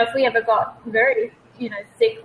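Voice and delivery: usual speaking voice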